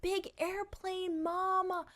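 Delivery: put-on high, slow little-kid chant; toddler voice. Toddler chanting about the airplane to mom, high slow kid voice. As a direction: sing it out like an excited toddler.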